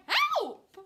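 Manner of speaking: high, loud cry for help; shrill-pitched yelp. A narrator's reading. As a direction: scream for help in bird panic. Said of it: "they all cried".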